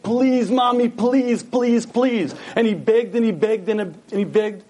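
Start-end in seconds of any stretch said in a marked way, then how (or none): 0.0-2.3 higher-pitched imitation of desperate pleading; retold begging, raised in pitch. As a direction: plead like a boy begging his mother. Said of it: "on his knees begged".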